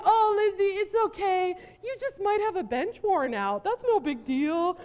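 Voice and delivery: high, loud, laughing mock reassurance; friends teasing through laughter. Friends mockingly reassure her about jury duty, laughing. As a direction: play the amused friends patting her on the head. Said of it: "but they were like".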